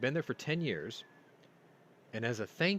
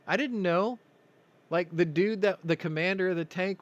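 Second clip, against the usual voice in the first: higher and louder, fired-up storytelling; excited; 9 semitones higher, 7 dB louder than usual. Real, not acted. Retelling firing the tank to listeners, excited and loud. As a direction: tell it like you're reliving the thrill.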